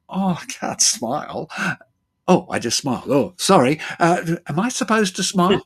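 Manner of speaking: client's protest. Retold or imitated. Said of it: "And they go".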